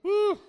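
a sudden high shouted woo; joyful whoop. Real, not acted. Preacher whooping at the congregation, loud high whoop. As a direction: let out a whoop of excitement.

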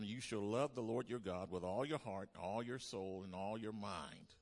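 loud, measured scripture proclamation; preached scripture quote. Real, not acted. Preacher reading Jesus's words to the church, loud proclamation. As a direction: proclaim each line like a verdict.

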